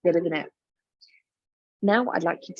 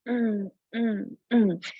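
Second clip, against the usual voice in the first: enjoying hum; 3 semitones higher, much slower than usual. Pretend. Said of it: "You're like".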